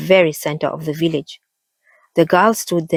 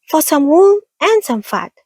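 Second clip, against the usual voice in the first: tiny mouse squeak, much higher; high squeaky voice; 11 semitones higher, 4 dB louder than usual. A narrator's reading. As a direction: squeak like a little mouse woman. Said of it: "a high, squeaky voice".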